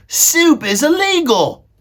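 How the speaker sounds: high, loud, drawn-out yell; Meg shouting. A narrator's reading. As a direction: holler the objection, stretching it out. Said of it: "shouted Meg".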